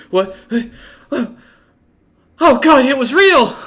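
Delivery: high, loud panicked cry; panicked yelp. Acted. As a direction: shriek it in sudden terror. Kobold wakes up terrified.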